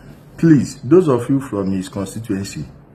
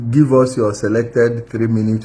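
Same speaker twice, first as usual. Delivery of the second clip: ordinary talk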